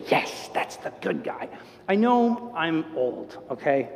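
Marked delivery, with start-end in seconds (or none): 0.1-1.5 whispered approval. Retold or imitated. Audience approving the hero.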